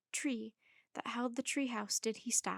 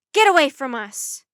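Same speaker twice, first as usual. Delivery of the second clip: very loud warning shout; yell; 2 semitones higher, 18 dB louder than usual. A narrator's reading. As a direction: yell to drive attackers off. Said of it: "he yelled".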